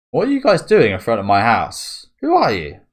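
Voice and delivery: mock angry homeowner shouting at reporters; angry challenge. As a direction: confront the intruders outside your house. Owner confronting strangers at house.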